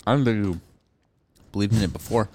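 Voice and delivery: usual speaking voice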